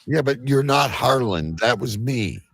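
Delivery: normal talking voice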